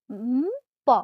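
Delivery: উম, প as a drawn-out "mmm-po"; teacher slowly joining m into প; conjunct pronunciation demo. Shown as a demonstration. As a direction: hum on closed lips, then release প. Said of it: "উচ্চারণটা এরকম".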